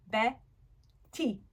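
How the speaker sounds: "Betty" split into two separate beats; syllable by syllable. Shown as a demonstration. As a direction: clap out "Betty" with a long gap between syllables. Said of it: "a word to clap out".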